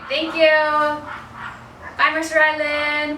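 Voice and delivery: sing-song high goodbye; bright sung farewell; cheerful sign-off. Real, not acted.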